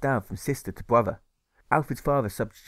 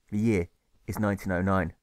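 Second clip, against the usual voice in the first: ordinary delivery, nothing marked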